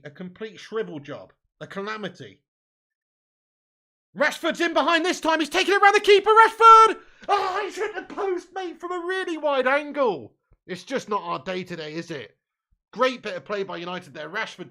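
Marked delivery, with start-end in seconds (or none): 4.1-7.0 loud, high, building yell as the chance opens; real fan commentary rising to a peak; excited commentary shout. Real, not acted. Live chance on goal. 7.3-10.3 high, slow, drawn-out wail of disbelief; real agony at a missed chance, easing off; anguished near-miss cry. Real, not acted. Chance hits the post.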